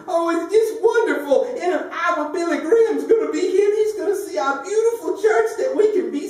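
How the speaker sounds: put-on very high gushing old lady voice; high giddy old lady, laughing through it; delighted old lady. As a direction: coo it like a thrilled church lady.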